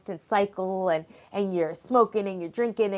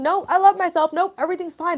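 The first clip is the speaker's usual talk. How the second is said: fake denial; 9 semitones higher, 5 dB louder than usual. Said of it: "if you're pretending".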